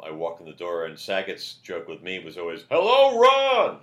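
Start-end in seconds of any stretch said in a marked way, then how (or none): 2.7-3.8 booming, high, drawn-out mock bellow; imitated loud greeting. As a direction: bellow the greeting like someone who yells everything. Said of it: "I yelled everything".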